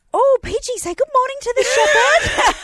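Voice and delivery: high, loud, gushing prompt; doting dog-talk. Retold or imitated. Owner tells Peaches to greet a shepherd, doting. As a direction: coax a dog like a toddler.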